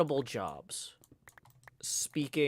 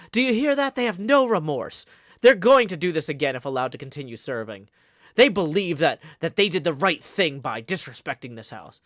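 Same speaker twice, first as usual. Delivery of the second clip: indignant; 3 semitones higher, 10 dB louder than usual. Retold or imitated. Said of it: "kept coming back to".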